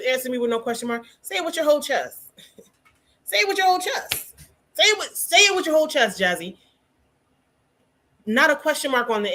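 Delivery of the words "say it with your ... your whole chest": high-pitched, insistent coaching; playful emphatic urging. Real, not acted. Coach pushing Jazzy to answer without a question mark, playfully insistent. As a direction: egg them on, pitch climbing.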